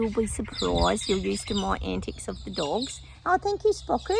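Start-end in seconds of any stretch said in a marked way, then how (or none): 3.3-4.2 much higher cooing thanks; high sing-song to the dog; pet talk. Real, not acted.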